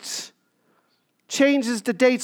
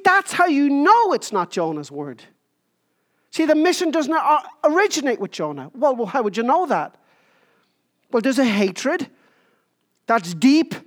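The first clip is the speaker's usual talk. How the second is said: ordinary talk, nothing marked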